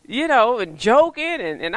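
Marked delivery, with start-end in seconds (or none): none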